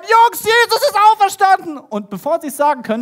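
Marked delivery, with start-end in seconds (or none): 0.0-1.8 high, loud acted cry of great news; excited shouting. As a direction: burst in yelling the news to your friends.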